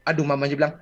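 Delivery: ordinary delivery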